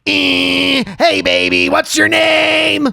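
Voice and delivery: slow drawn-out catcall shout. Pretend. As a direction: holler it at a passing girl. Bugs catcalling like boys.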